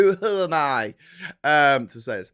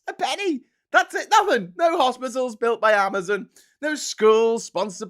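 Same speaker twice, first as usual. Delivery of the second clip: much higher, loud disbelief; incredulous outrage; 9 semitones higher, 6 dB louder than usual. Real, not acted. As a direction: exclaim in outrage. Outraged about Amazon tax.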